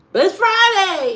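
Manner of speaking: high, loud gleeful Friday; Friday excitement. Real, not acted. Streamer telling chat it's Friday, high and loud.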